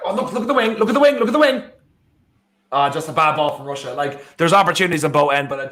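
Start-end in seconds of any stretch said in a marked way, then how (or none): 0.0-1.6 high, rapid-fire urging; urgent excitement. Real, not acted. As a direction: urge the players on from the sofa. Urging players during live match.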